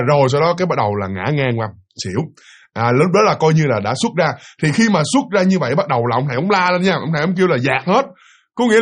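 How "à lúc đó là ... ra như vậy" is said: normal talking voice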